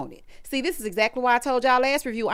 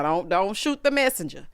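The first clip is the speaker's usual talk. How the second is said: ordinary delivery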